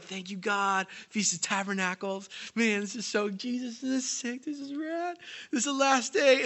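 a light surfer-dude disciple, chill and upbeat; casual disciple voice. Acted. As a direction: hype it up like a laid-back bro.

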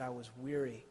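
soft, low, worn-out admission; weary confession. Acted. Jeremiah confessing to God, soft and worn out. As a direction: barely get the words out, spent. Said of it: "he said".

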